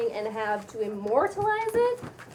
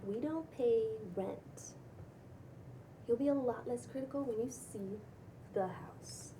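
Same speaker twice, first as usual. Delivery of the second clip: softer, hushed secret-sharing whisper; conspiratorial whisper; 7 dB quieter than usual. Acted. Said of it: "talks quietly, conspiratorially".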